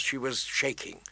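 ordinary delivery

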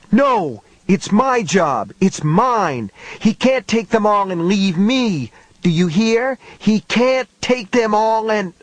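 put-on high desperate boy screaming; a child fighting in a frenzy; frantic child. David struggling in a frenzy.

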